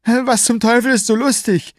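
high, strained, sneering thug voice; villain's rant. A narrator's reading. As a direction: taunt her from high in the throat. Villain angered by smile.

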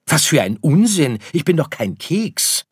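high, loud protest; offended little star objecting; indignant. Acted. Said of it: "empörte sich Sirius". (laut und hoch empört)

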